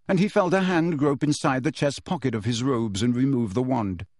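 plain reading voice

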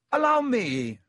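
high, showy call; shouted. A narrator's reading. Lockhart stepping in to deal with the snake, shouted. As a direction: call it out high, falling at the end. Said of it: "shouted Lockhart".

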